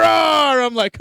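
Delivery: ordinary talk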